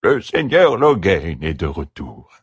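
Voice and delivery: put-on slow, trembling old servant; quavering old voice. A narrator's reading. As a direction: creak it out like a frail old man. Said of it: "dit-il d'une voix chevrotante".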